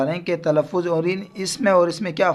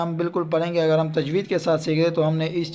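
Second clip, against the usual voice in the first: ordinary talk, nothing marked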